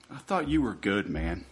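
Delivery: ordinary talk, nothing marked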